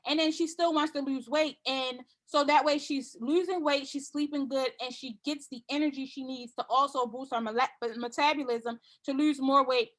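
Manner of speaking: ordinary talk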